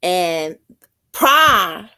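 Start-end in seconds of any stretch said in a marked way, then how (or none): 1.1-1.9 "prime" punched high and loud; strong stress on "prime". Shown as a demonstration. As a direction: hit the syllable "prime". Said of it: "is the stressed syllable in the word".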